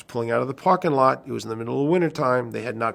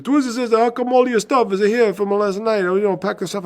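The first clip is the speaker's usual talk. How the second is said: put-on much higher old man voice; old custodian; 9 semitones higher, 4 dB louder than usual. Custodian on the phone at the club, high old voice. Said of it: "He goes".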